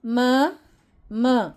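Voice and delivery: "म" as a short ma sound, not "em"; letter sound m. Shown as a demonstration. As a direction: sound out "म", repeated. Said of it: "इसको पढ़ते हैं म".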